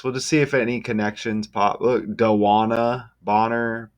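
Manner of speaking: usual speaking voice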